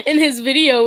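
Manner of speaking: high, loud burst of laughter; laughing. Real, not acted. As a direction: crack up mid-sentence.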